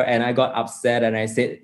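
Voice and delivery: ordinary talk, nothing marked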